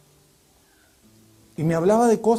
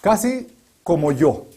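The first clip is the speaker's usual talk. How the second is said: ordinary delivery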